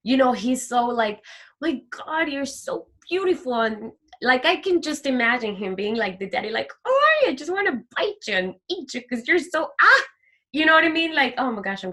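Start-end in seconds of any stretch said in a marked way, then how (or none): 1.6-3.5 tender doting. Retold or imitated. Voicing God's delight. 6.9-10.0 put-on high gushing baby talk; doting daddy gush. Daddy God gushing over his child, high baby-talk.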